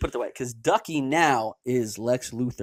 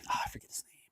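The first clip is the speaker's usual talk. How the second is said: muttered aside; whispering; 14 dB quieter, much faster than usual. Real, not acted. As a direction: whisper it to yourself. Muttering to himself mid-sentence.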